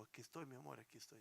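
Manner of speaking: put-on calm your little girl back to sleep; father hushing his daughter in the dark, very soft; soothing whisper-soft reassurance.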